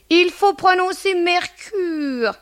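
high, slow, affected correction; pursed-lip affectation. Retold or imitated. Landlady mimicking lodger correcting her name, affected pout. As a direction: say MERCURE through a prim pout. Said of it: "la bouche en cul de poule".

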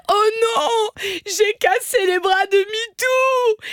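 put-on high wailing child voice; crying child. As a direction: wail it like a sobbing boy. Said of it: "il commence à pleurer".

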